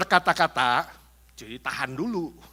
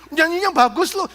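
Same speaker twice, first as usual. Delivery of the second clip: bright laughing praise, higher and louder; laughing compliment; 8 semitones higher, 5 dB louder than usual. Retold or imitated. Friend praising someone's singing, laughing.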